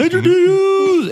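drawn-out hyped announcer call; announcer hype. Acted. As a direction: introduce him like a game show host. Introducing special guest.

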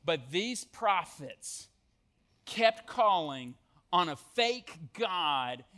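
usual speaking voice

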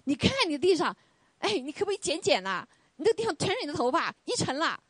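mock-annoyed husband scolding his wife; husband's complaint. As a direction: nag about the hair on the floor. Husband complains about hair on floor.

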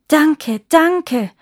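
loud, high cry of gratitude; fervent thanks. A narrator's reading. Emperor thanks the nightingale, fervently. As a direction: cry it out with relief.

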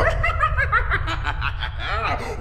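high evil cackle; high villain laugh; gloating cackle. Acted. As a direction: cackle in triumph. Trickster gloats.

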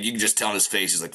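normal talking voice

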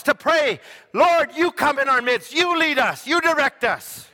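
loud, high, shouting sermon; preaching at full force. Acted. Pastor warning the church against chasing new things, shouted preaching. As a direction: thunder it at the congregation.

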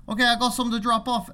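quick, high-pitched imitated Aussie; Australian accent. Trucker announcing a drop-off, quick mock Australian accent. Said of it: "Australian slash New Zealand".

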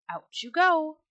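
loud, high storybook shout; shouted order. A narrator's reading. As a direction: shout it to send them out. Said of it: "opened the door of the cage and shouted".

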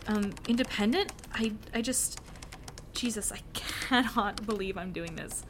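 ordinary delivery

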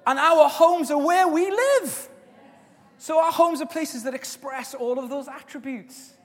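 high, loud preaching cry; full-force preaching. Acted. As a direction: preach it out to the whole room.

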